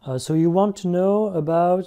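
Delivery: drawn-out English filler, slowed right down; slow stalling question. Shown as a demonstration. Coach showing a candidate how to stall, slow and drawn out. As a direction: stretch it out to buy yourself time. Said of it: "ça peut être des choses du style".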